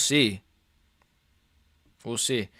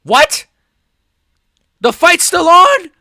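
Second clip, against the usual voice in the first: shouted disbelief, much higher and louder; stunned shouting; 11 semitones higher, 10 dB louder than usual. Real, not acted. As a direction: yell in shock at the screen. Fight restarts unexpectedly, streamer stunned.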